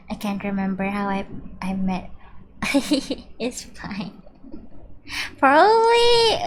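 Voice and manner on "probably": squeaky high start; high squeak. Real, not acted. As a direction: jump way up on it.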